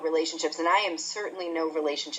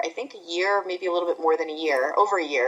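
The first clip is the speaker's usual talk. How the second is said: usual speaking voice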